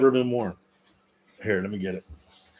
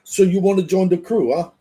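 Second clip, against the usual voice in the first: higher, louder Jersey wiseguy voice; Jersey Guido; 8 semitones higher, 5 dB louder than usual. Pretend. Guido asks newcomer about joining the crew, put-on Jersey voice. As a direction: play a Jersey Guido sizing up a recruit. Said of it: "have to get a Jersey Guido uniform".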